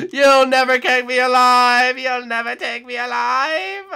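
loud, high, slow, breaking into a mock cry; melodramatic defiance. Taunting the killer Nick from a hiding spot, melodramatic and high. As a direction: declaim it like a doomed hero.

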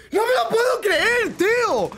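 furious high-pitched shout; raging gamer. Real, not acted. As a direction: scream it out in disbelief, slowly. Repeated rage shout.

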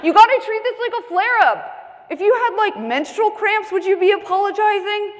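high, loud, insistent retort; loud friend. Retold or imitated. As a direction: scold her supportively at high pitch, no accent. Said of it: "one of my louder friends".

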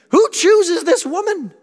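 high, slow, loud incredulous cry; a shouted, disbelieving question; incredulous outcry. Real, not acted. Preacher asks the crowd, in a high, loud incredulous cry. Rhetorical outburst in sermon.

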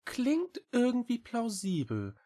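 high-pitched small cat voice; little cat. A narrator's reading. Cat agrees. (hohe Katerstimme)

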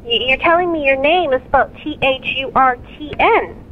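ordinary talk

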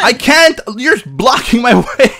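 high, very loud protest; frantic yell with laughter breaking in; panicked scream. Real, not acted. Trapped, yelling at teammate.

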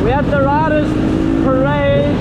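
high, loud hype; excited. Real, not acted. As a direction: cheer it up, excited. Hyping the riders parade.

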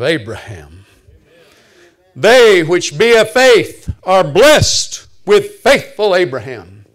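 loud, drawn-out pulpit declaration; ringing preacher's proclamation; full-force preaching. Real, not acted. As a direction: proclaim the scripture with big, slow emphasis.

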